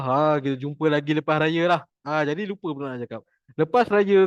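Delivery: ordinary talk, nothing marked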